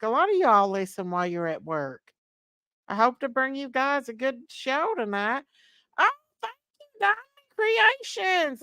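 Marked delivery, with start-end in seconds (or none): 6.0-8.6 high, loud delighted gasp of thanks; excited thanks. Real, not acted. Host thrilled by a viewer's gift, high excited thanks. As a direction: squeal your thanks up high.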